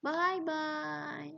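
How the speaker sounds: put-on high-pitched baby-talk sing-out. Shown as a demonstration. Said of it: "This is for baby talk".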